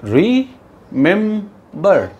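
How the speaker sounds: "Remember" slowly as REE, MEM, BAR with gaps; three separate syllables. Shown as a demonstration. Teacher counts syllables, "remember" split ree-mem-bar. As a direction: break "remember" into three strong, drawn-out beats. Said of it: "तीन syllable है".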